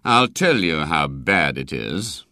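low, slow, stern threat; very grim. A narrator's reading. Doctor about to expose the boy to his mother, grim. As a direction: drop the pitch and spell it out grimly. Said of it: "said Doctor Bell still more grimly".

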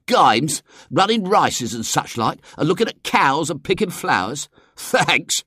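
put-on high, scornful boy's voice; infinite scorn. A narrator's reading. Check-cap boy mocking country games, scornful. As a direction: sneer at each childish pastime. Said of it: "said the boy in the check cap with infinite scorn".

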